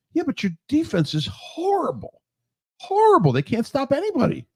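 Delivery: mocking the defense; exasperated. Real, not acted. Ranting at Bengals defense.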